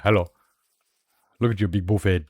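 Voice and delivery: usual speaking voice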